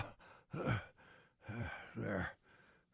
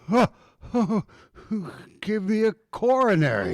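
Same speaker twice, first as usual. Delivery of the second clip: startled gasp; 10 semitones higher, 5 dB louder, faster than usual. Acted. Startled by the child in doorway.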